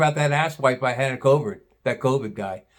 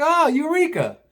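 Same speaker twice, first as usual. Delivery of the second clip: mock eureka; 14 semitones higher than usual. Mock relief at the scale.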